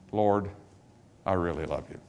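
soft, low confession of love; humble sincerity. Retold or imitated. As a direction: confess it quietly, without any show. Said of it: "Instead of boasting".

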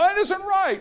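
very high, comic protest; mock indignant old man; arguing old man. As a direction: insist in a squeaky put-on old man's voice. Imitating arguing nursing-home man.

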